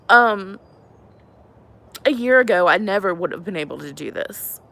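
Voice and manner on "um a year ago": usual speaking voice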